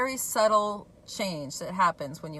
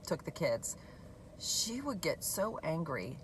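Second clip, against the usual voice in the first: ordinary delivery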